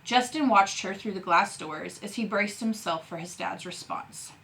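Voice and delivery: ordinary delivery, nothing marked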